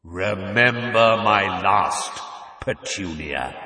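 slow, booming, ominous echo; awful echoing voice. Acted. Burning letter warning the aunt, awful and echoing. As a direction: intone it slowly like a voice from the flames. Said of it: "An awful voice filled the kitchen, echoing".

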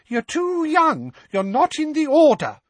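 very high, shrill mock-mother voice; mock your mother's scolding in falsetto; mimicking his mother. A narrator's reading. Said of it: "in a high-pitched voice that sounded uncannily like his mother's".

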